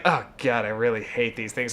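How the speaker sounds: put-on low, quiet grumble of a displeased girl; grudging disgust. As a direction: imitate a bratty kid's reluctant, muted complaint. Ungrateful girl receiving grandma's dish.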